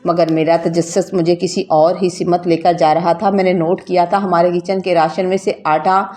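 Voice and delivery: ordinary delivery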